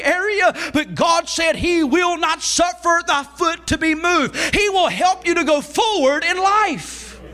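driving, high-pitched sermon delivery; Southern revival preacher in full flow; full-force preaching. Real, not acted. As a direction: preach it with rising intensity.

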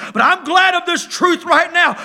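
ordinary delivery

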